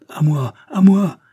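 anguished slow shout; distress cry. Acted. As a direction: call out in distress. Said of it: "d'une voix de détresse".

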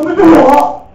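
高声自信喊出这句; 像对自己宣示一样大声喊; loud affirmation. Shown as a demonstration. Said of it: "就说". (老师示范看到单词就大声自我肯定)

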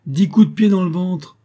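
lancée fort et haut, moqueuse; réplique cinglante. A narrator's reading. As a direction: rabroue le gamin d'un trait. D'Artagnan rabroue le gamin. (D'Artagnan répond sèchement à Raoul)

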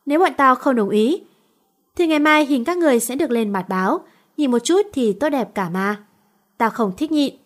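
ordinary delivery, nothing marked

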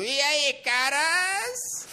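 high, drawn-out alien greeting. Acted. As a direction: greet them like a weird alien dandy. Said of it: "Ele fala, tipo".

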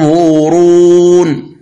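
ينظرون recited slowly with a long, full nasal ghunna on the نون; slow Quranic ينظرون with a heavy hum. Shown as a demonstration. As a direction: hold the hidden نون of ينظرون in the nose, heavy. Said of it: "تقول".

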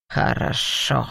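low growled assent; demon's growl. A narrator's reading. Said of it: "прорычал демон". (низкое рычание демона)

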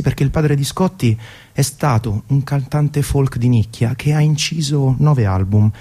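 normal talking voice

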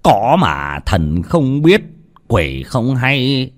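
chậm rãi, kéo dài, cao dần cuối câu; nói chậm, hả hê; đắc ý gian ác. Acted. (cụ Lý hả hê về mưu thủ tiêu xác)

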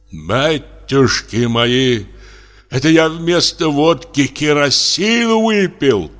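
man realizing he drank kerosene, horrified. A narrator's reading. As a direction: gasp it out as the horror dawns. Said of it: "ужаснулся он".

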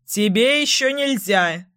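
narrator's mild parting call. A narrator's reading. Tom walking away from the underage girl, calling out. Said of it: "крикнул он, удаляясь".

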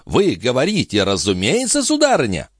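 incredulous question, jumping high on the last word; exclaimed disbelief. A narrator's reading. Police chief querying the countess, incredulous. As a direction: question her, taken aback. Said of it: "воскликнул господин де Сартин".

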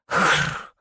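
low throat rasp without words; choking, hoarse rattle; rasping wheeze. A narrator's reading. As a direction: wheeze hoarsely as breath fails. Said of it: "захрипела, максимально расправляя лёгкие".

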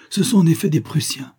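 high-pitched startled confirmation; exclamation. A narrator's reading. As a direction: exclaim it, pitch jumping well up. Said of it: "s'exclama Soult". (exclamation aiguë)

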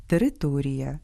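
ordinary talk

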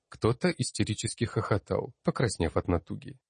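plain reading voice

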